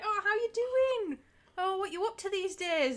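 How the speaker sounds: imitating a gushing family friend, pitched high; high, keen small talk; over-friendly chat. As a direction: greet them like a long-lost favourite. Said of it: "this is really friendly".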